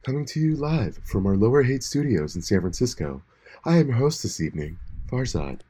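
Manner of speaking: low, hushed NPR host voice; mock serious radio announcer; public radio announcer.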